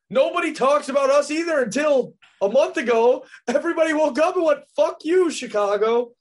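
high, loud, indignant rant; heated. Real, not acted. Bears fan venting at co-host about the doubters, heated. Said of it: "Why are you so heated?".

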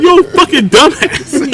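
high, slow mock scolding; imitated doctor's insult. Doctor cursing back at patient, slow mock insult.

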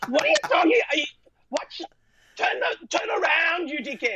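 high-pitched indignant squawking; mock outrage. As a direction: shriek in comic disbelief at the interruption. Acts out reaction to ill-timed selfie request.

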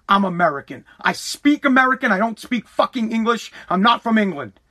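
ordinary talk

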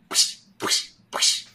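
breathy belt snaps; imitating a snapping belt with puffs of air; belt snap sound. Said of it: "he'd snap it".

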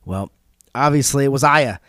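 normal talking voice

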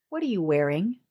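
low, soft sultry murmur. Pretend. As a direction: purr it seductively. Prank line to telemarketer.

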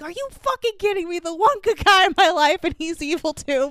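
high, fast mock outrage; hammed-up comic fury at the world; mad at life. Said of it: "He's just mad at life".

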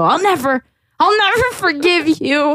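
high, hammed-up angry threat; mock-furious kid threatening mom; acted anger. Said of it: "I'll do angry".

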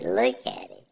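ordinary delivery

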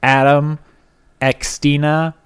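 ordinary talk

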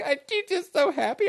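high, gushing mock wife voice; a hammed-up happy wife; wife's voice. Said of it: "She's like".

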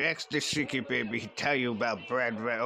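usual speaking voice

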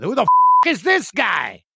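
hammed-up friend pretending not to know him; golf buddies' mock outrage. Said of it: "the guys I golf with".

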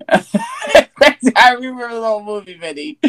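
laughing through the line; repeated line now laughing. Real, not acted. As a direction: say it while cracking up.

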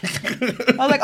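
ordinary delivery

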